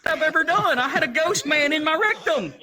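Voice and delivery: high, loud, squealing exclamation; comic outrage. Acted. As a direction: shout it in hammed-up dismay.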